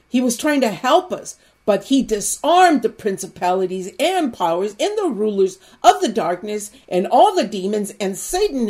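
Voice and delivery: preacher gathering steam; rising preaching. Acted. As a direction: build the sermon up toward the peak. Preacher building toward a climax.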